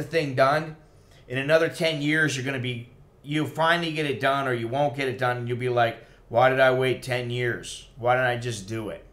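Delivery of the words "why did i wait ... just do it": ordinary delivery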